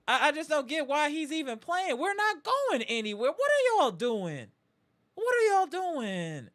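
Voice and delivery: high-pitched, incredulous venting; exasperated outburst. Real, not acted. Host questioning team for playing injured star, exasperated outburst. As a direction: climb high and stretch the question in disbelief. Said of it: "just had to get that off my chest".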